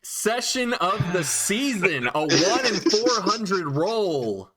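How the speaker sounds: slow, gloating glee, ending in a high whoop; gleeful. Real, not acted. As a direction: savour the disaster, then whoop. GM delights at double nat one.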